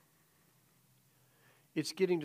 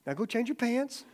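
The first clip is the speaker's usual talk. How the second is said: high mock-stern joke; teasing dad; 9 semitones higher, 4 dB louder than usual. Father jokes to scared son, high teasing. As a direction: deliver the tag like a teasing dad.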